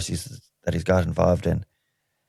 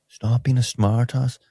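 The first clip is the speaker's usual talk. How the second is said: slow, higher, badly faked American accent; botched American accent; 4 semitones higher, slower than usual. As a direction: attempt American, land nearer Belfast. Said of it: "trying to have an American accent".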